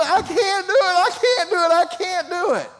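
put-on high, teary child pleading he can't; tearful little boy. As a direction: play a three-year-old about to cry. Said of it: "he just teared up and he said".

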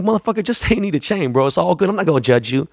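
high, laughing through the words; ribbing a friend, cracking up; laughing tease. Retold or imitated. Teasing [name] about the chain, laughing high.

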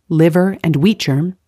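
ordinary talk

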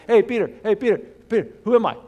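loud, high-pitched teasing call; goofing around. Pretend. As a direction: heckle a friend across the campfire. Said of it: "see them goofing around".

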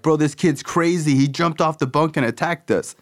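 high, put-on tattletale voice; mock the kids playing innocent; whiny accusation. Kids blaming the new boy to the supervisor, whiny mock.

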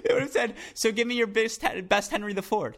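half laughing while voicing a fan. Real, not acted. Imagined fan asking for a joke, laughing.

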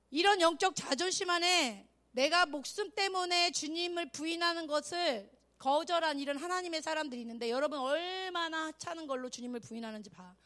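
ordinary delivery

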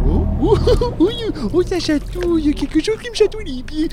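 high giggly squeals; being tickled. Acted. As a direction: laugh while tickled underwater. Swimmer tickled by something.

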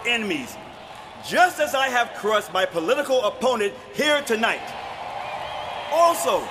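barbarian warlord roaring to crowd; shouting speech. Acted. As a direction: bellow it like a conquering tyrant.

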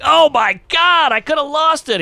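high, slow gasp of shock; slow, high stunned exclamation; stunned relief. Retold or imitated. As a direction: drag it out in disbelief.

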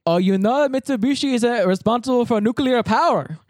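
put-on high-pitched Indian-accented voice; imitated Indian accent. Job seeker chatting in elevator, imitated Indian accent. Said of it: "I'll do the voice".

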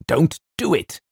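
high, urgent protest; vehement cry. A narrator's reading. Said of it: "cried James with extraordinary vehemence".

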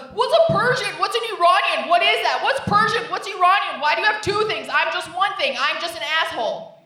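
loud, high dumb guy voice; confused guy. Retold or imitated. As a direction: play a loud clueless shopper asking questions. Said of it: "And he goes".

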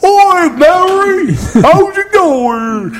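high, drawn-out hammed-up Cockney; exaggerated slow Cockney squawk; Cockney accent. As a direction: bellow a bad Cockney greeting, stretched out long. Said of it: "That's my Cockney accent".

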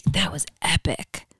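whispered Colorado skier line; whispered quote. Retold or imitated. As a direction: breathe it out in a whisper. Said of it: "what they say in Colorado".

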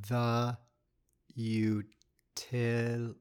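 drawn out syllable by syllable; slow, teasing reveal, piece by piece. Shown as a demonstration. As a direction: stretch it out slowly with gaps. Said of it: "say it very slowly".

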